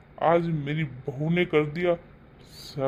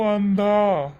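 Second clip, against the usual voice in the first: अंधा said louder with a falling tune; father scolding his son, hitting अंधा hard; scolding stress; 3 semitones higher, 5 dB louder, much slower than usual. Acted. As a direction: snap out अंधा as a rebuke.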